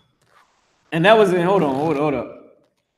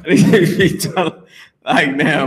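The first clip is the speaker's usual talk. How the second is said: slower, laughing through the words; 3 semitones higher, slower than usual. Real, not acted. Urging Yannick to hurry, laughing at himself. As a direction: repeat it while cracking up. Repeats own line, then laughing.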